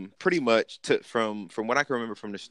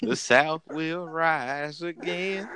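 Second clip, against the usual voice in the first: mock Southern rally cry; 7 semitones higher, much slower than usual.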